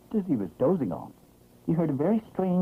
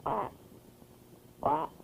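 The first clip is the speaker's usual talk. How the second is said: duck quack; 8 semitones higher, 7 dB quieter than usual. A narrator's reading. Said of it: "the first thing he said was".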